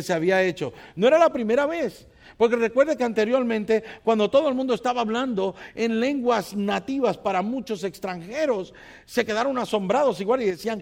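loud, fervent sermon delivery; preaching. Acted. Preacher to his congregation, loud fervent preaching.

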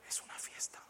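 unvoiced conspiratorial whisper; whispered aside. Shown as a demonstration. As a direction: let them in on the secret. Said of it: "whispered aside to audience". (susurrando al público)

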